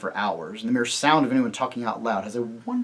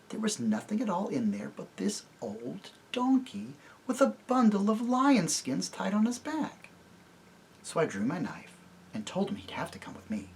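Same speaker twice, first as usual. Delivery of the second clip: whisper; 7 semitones higher, 6 dB quieter than usual. Acted. Jill whispering her tale.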